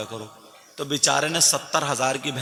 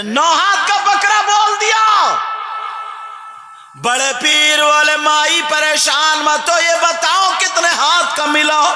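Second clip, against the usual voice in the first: higher, chant-like preaching at full force; impassioned preaching; 14 semitones higher, 10 dB louder than usual. Acted. Preacher voicing the foolish old woman to the congregation, impassioned chant. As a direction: sing out the old woman's regret to the crowd.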